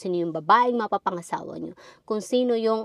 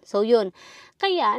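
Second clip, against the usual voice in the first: usual speaking voice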